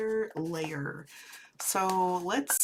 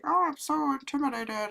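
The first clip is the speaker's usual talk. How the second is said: higher, mock-nervous voice of others; mock intimidated; 8 semitones higher than usual. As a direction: imitate timid strangers, pitched up. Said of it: "people would be like".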